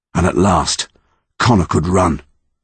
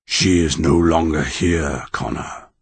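slow, lower-pitched pronouncement; solemn monster; 4 semitones lower, 4 dB quieter, slower than usual. A narrator's reading. Original monster to Connor, slow solemn voice. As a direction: deliver it gravely, word by word.

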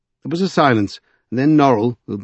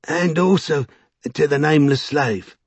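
lightly voiced Vinculus, pitched higher; Vinculus's voice; 6 semitones higher than usual. A narrator's reading. Vinculus adding to his message, in a lightly raised voice. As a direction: put on a slightly raised character voice.

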